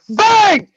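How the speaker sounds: high, loud impact shout; shouted bang. Retold or imitated. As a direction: shout the hit like a sound effect. Impact sound effect.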